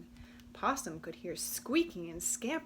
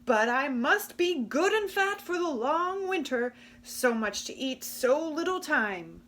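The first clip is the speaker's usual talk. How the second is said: louder, greedy gruff voice; growly Raccoon; 6 semitones higher, 8 dB louder than usual. Acted. Raccoon fretting about winter fat, loud and gruff. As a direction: fret about eating enough. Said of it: "growled Raccoon".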